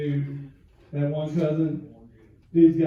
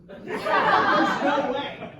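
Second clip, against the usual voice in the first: high drawn-out disbelief; 6 dB louder, much slower than usual. Real, not acted. Repeated no way, disbelief.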